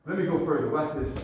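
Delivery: ordinary delivery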